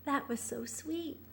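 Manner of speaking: ordinary delivery, nothing marked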